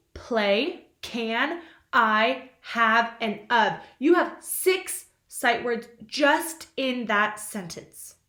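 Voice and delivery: ordinary talk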